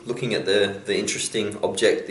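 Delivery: usual speaking voice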